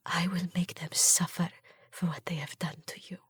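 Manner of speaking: toneless whisper, very soft. A narrator's reading. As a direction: breathe the words to the dead children. Whispered vow over the dead.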